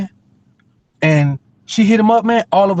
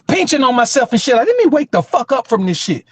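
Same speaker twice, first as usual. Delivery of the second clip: ordinary talk, nothing marked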